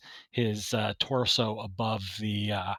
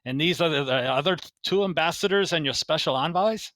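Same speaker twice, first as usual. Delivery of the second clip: higher, louder shopkeeper voice; quartermaster voice; 5 semitones higher, 4 dB louder than usual. Acted. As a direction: greet the guests like an eager shopkeeper. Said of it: "He goes".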